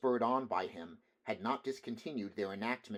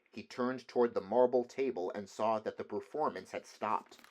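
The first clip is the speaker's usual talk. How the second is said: usual speaking voice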